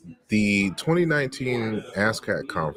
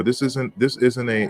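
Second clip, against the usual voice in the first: ordinary talk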